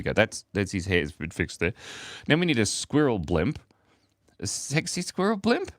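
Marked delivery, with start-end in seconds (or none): 4.4-5.7 high, giggly question; amused disbelief. Real, not acted. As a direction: ask it through rising laughter. Cracking up at viewer's request.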